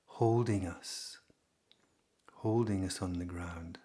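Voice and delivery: calm, unhurried delivery with long pauses; guided meditation. Real, not acted.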